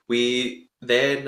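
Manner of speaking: normal talking voice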